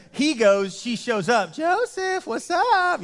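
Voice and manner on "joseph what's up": high singsong come-on, words stretched; flirty pursuing wife. Acted. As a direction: play the wife cooing after Joseph, far higher.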